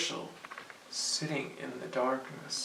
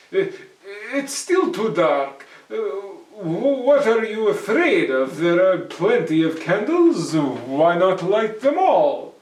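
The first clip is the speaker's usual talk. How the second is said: put-on louder, brash young man brushing it off; bold Herschel, scoffing; 6 semitones higher, 12 dB louder than usual. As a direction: call his bluff out loud. Herschel nervously coaxing goblin.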